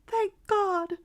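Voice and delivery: very high and loud burst of joy; second thanks, overflowing with tears; joyful cry rising to its peak. A narrator's reading. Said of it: "cried the mother with a rush of glad tears".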